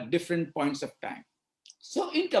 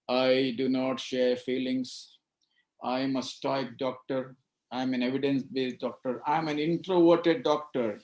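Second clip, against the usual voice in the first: stoic doctor; 8 semitones lower than usual. Retold or imitated. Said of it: "what happens to doctors?".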